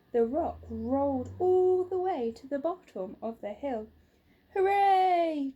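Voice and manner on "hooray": loud, high joyful cheer. Acted. Mister Quest cheering as the rock rolls away. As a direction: shout it out in triumph. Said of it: "cheered Mister Quest".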